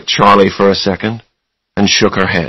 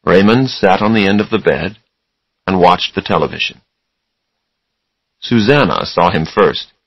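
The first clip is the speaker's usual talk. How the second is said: plain reading voice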